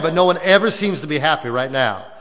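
usual speaking voice

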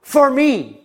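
loud, high, fervent sermon delivery; preaching at full force. Acted.

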